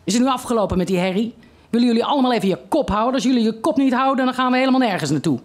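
put-on father fed up with the kids, scolding fast; angry father. A narrator's reading. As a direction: snap at the kids in the back seat. Father scolds squabbling children.

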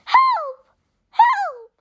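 high, loud cries for help; calling for help. A narrator's reading. Chip spins into the magic adventure, calling for help. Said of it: "called Chip".

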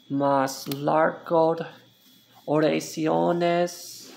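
slow, careful word-by-word reading. Shown as a demonstration. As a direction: read each word out slowly for learners. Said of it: "some longer sentences".

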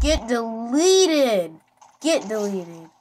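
slow, loud, drawn-out taunt with DELETED dragged; mock-dramatic shouted taunt. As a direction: savour each word like a finishing blow. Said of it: "It's so funny saying that".

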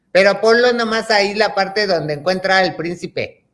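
high, wheedling insistence; pleading child. Retold or imitated. Acting her child self asking mom for the prince part, pleading. Retold child bargaining with mother.

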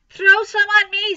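high, loud eager call; boy clamouring. A narrator's reading. Boy wanting water thrown too, clamouring. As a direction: pipe up loudly for a turn.

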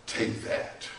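very soft, near-whispered order; quiet divine command. Acted. God telling Moses to take the stick, hushed. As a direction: understate it, almost no voice.

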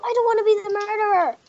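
fast high shout; shouted quote. Retold or imitated. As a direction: yell it out in a rush. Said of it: "Oliver asked you".

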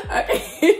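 high giggle into a breathy okay; real giggles. Real, not acted. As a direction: laugh it off and regroup. Laughing fit continues.

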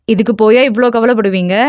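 usual speaking voice

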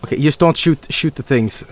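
usual speaking voice

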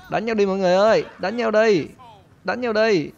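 egging on. Pretend. Egging on a street brawl.

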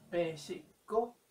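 slow "México" with the M barely closing the lips; soft lips M. Shown as a demonstration. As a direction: say "México" slowly, lips almost not touching on the M. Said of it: "casi no juntamos los labios".

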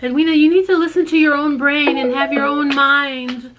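high, loud mock lecture; mocking commenter voice. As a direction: read the comment in a preachy voice. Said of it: "what did they actually say".

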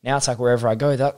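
ordinary talk, nothing marked

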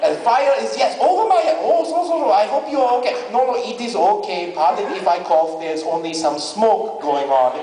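put-on comic unruffled Japanese accent; calm Japanese accent. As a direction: report disaster politely in a Japanese accent. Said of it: "Japanese family that gets very calm, cool, and collected".

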